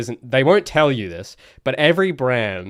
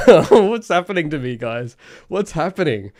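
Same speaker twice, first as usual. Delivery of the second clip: rising, louder mock panic; mock alarm; 5 semitones higher, 5 dB louder than usual. Real, not acted. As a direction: throw up your hands at yourself, laughing it off. Mock panic at own typing.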